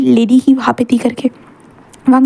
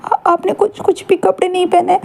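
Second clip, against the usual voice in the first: shy stammer; 6 semitones higher than usual. Acted. Shy Wing stammers at naked husband.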